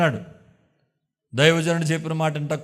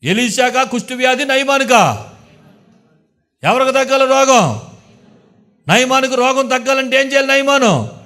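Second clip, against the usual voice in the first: louder, higher challenge to the congregation; rising sermon questions; 8 semitones higher, 7 dB louder than usual. Acted. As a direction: press the crowd with each question. (పెరుగుతున్న స్వరంతో ప్రశ్నలు)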